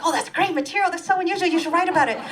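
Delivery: high, gushing enthusiastic friends; friends' voice. Retold or imitated. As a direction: gush like cheerful writer friends. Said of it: "They're like".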